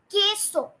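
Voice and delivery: plain k, silent u in queso: KEH-so; correct pronunciation. Shown as a demonstration. Teacher confirming the word for cheese, queso said KEH-so. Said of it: "is gonna be".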